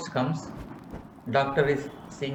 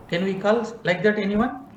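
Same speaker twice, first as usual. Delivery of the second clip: ordinary talk, nothing marked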